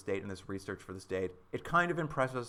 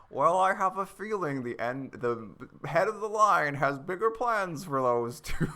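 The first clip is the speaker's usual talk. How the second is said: higher, slow theatrical mystery-host voice; Vincent Price impression; 6 semitones higher, 4 dB louder, slower than usual. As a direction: purr it like a spooky old film host. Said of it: "Vincent Price is like".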